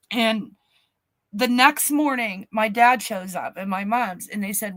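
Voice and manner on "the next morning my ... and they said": plain reading voice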